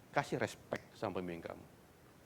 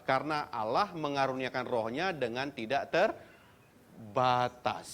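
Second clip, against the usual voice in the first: scripture reading; 5 semitones higher, 6 dB louder than usual. Acted. Reads scripture aloud to congregation.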